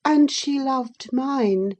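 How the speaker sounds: put-on slow, high, needling boy; smug childish jab; taunting boy. A narrator's reading. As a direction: draw it out to provoke her. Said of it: "added he".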